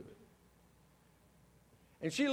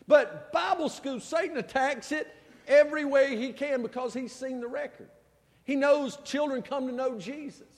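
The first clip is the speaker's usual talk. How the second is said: higher, louder preacher drive; preaching; 9 semitones higher, 6 dB louder than usual. Acted. Pastor telling the church about Bible school, fervent preaching. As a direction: preach it with fervour.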